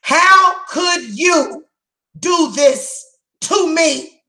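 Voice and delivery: anguished plea. Retold or imitated. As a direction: stretch every word like a wounded cry. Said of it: "saying to that person".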